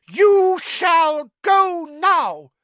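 high, loud, slow elf command; squeaky elf giving a fierce order; fierce house elf. Acted. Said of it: "he said fiercely".

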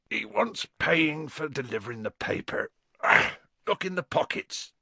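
lightly voiced big gruff giant; Hagrid voice. Hagrid half asleep explaining to Harry, lightly voiced.

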